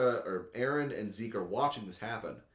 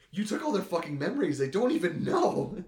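higher-pitched mock indignation; acted outrage; 8 semitones higher, 5 dB louder than usual. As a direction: retell it as an incredulous protest. Acting out an outraged argument.

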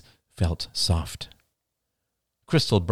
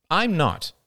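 simple admission; 9 semitones higher than usual. A narrator's reading. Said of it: "said Crystal simply".

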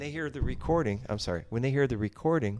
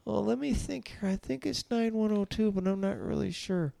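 hammed-up confused old caller, pitched way up; forgetful old person; 10 semitones higher, 8 dB louder than usual. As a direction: dither in a high old caller's voice. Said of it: "old people forgetting or not doing it".

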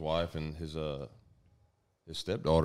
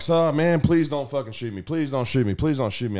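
panicked begging; 7 semitones higher, 8 dB louder than usual. Retold or imitated. Intruder begging the armed father-in-law, loud frantic pleading. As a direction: beg for your life over and over.

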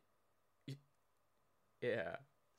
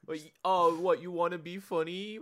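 higher, louder sneering; mock sneer; 9 semitones higher, 9 dB louder than usual. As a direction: sneer at the try-hard.

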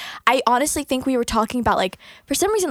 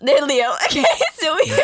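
laughing; 3 semitones higher, 5 dB louder than usual. Real, not acted. Laughs while restarting story.

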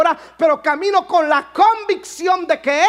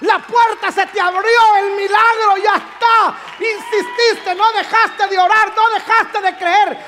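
preaching at full force; 4 semitones higher than usual. Acted. Preacher declares the miracle over the woman, full-voiced shout. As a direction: proclaim it like a blessing from the pulpit.

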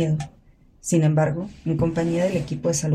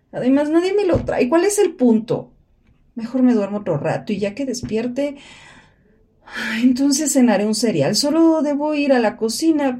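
anxious complaint; 6 semitones higher, 3 dB louder than usual. Acted. Anxious complaining self-talk.

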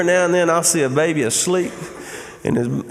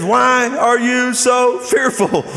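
slow, raised, pointed question; drawn-out, higher-pitched reproach; aggravated tone; 7 semitones higher, slower than usual. Acted. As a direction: space out the words with exasperation. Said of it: "I think we can see a little tone".

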